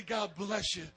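ordinary talk, nothing marked

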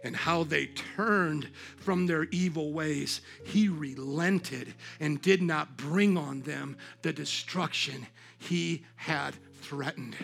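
slow, low and soft; measured reading, pausing between phrases; solemn scripture reading. A narrator's reading. Preacher reads how God relented, hushed and solemn.

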